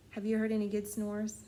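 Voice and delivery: ordinary delivery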